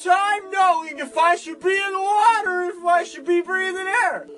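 high, loud confused creature. Acted. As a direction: bellow like a confused hybrid beast. Creature shouting in skit.

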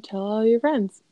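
ordinary delivery

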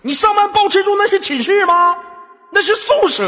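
high, loud, exasperated protest; mock-angry correction. Host correcting caller's word for dorm, mock-angry. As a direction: hammer the correction home in mock outrage.

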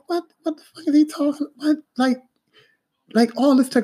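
high-pitched retold outrage; mad, incredulous. Apprentice reacting to the foreman's easy method, mad. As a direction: replay the disbelief way up high. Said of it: "So I'm mad as shit".

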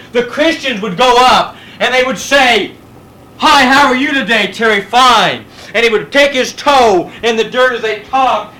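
a preacher telling the church a catacomb tale with lifted voice. Acted. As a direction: preach it up, pitched high and strong. Storytelling with raised preaching voice.

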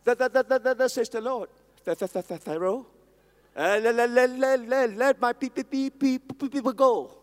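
mock diffident stammer; stammering Moses. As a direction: stammer your way through the command. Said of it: "with his stammer".

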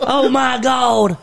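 high, shouted mock amazement; hyped-up commentator. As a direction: hail the bus driver like a surprise wrestler. Acted commentary on a bus.

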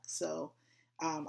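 usual speaking voice

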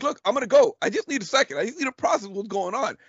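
high-pitched pleading for a moment; retold flustered protest. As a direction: replay an overwhelmed argument, pitch pushed up.

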